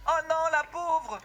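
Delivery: high, slow, drawn-out wail of sympathy; shocked pity. Real, not acted. Reacts to character being burnt. (exclamation apitoyée, aiguë et traînante)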